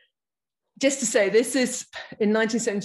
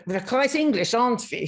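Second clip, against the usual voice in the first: a gentle imitation of her refugee grandmother's German accent; 4 semitones higher than usual. Grandmother joking about cornflakes for breakfast, in a light German accent. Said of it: "I have to do my grandmother's accent".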